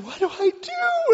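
very high, drawn-out wail; mock panicked call. Wife asking husband for help, high panicked call. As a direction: imitate a panicked wife calling high and loud.

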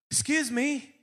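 put-on high-pitched Irish accent. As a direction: imitate an Irishman politely butting in, pitched high. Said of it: "He's got this great accent".

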